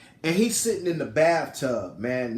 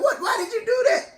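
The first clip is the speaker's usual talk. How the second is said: very high incredulous cry; retold alarmed, high-pitched question; acted-out shock; 3 dB louder, faster than usual. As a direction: shriek it in mock disbelief. Husband shocked she quit for him.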